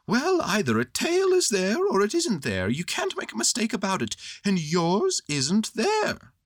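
patiently sure of himself; high little bear voice; matter-of-fact Pooh. A narrator's reading. Pooh's voice.

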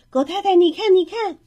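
put-on high, loud child's call to a neighbour. A narrator's reading. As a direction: call out eagerly to show something off. (兴奋地大声喊人来看)

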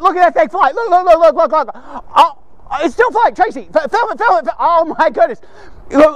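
high, excited rapid repeats; real excitement. Real, not acted. Speaker to Tracy, film that thing flying, excited. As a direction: urge her on in real amazement. Reacts to a flying paper plane.

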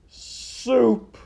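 high, loud wail; moaned. Acted. Rat remembers soup and begins to cry, moaned. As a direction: swell into an anguished moan. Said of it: "moaned Roscuro".

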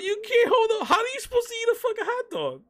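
high incredulous rant; exasperated, voice jumping up; outraged disbelief. Real, not acted.

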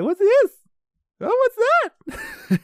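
very high, loud mum voice; over-the-top falsetto mother; impression of mum. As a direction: squeal it like an excitable mum. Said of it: "pretty good impression of my mum".

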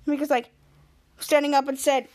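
ordinary talk

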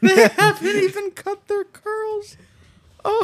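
high laughing repeat. Retold or imitated. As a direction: squeal it through laughter. Mocking friend's line laughing.

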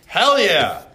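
loud hype shout of approval; excited cheer. Real, not acted. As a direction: cheer the answer loudly. Cheering a student's answer.

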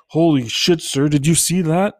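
high, loud alarmed outburst; shocked. Retold or imitated. Stunned after cars nearly hit the blind man, loud shocked. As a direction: blurt it out in shock.

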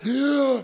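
a hammed-up excited gasp, pitched high; mock gasp at Bitcoin's rise. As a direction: gasp like a thrilled crypto fan. Mocking crypto lover's excitement.

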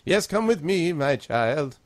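put-on high, kindly old gnome; lilting grandmotherly character voice. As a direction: thank them as an elderly gnome priest. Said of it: "You have the best grandmother gnome voice".

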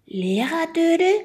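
puzzled. A narrator's reading. Mika echoes the odd word, baffled and rising. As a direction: repeat the strange word in confusion. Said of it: "fragte Mika verdutzt".

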